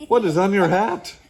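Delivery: loud, high curiosity; high-pitched hollered question; boisterous question. Acted. Noisy Bean asks Frank.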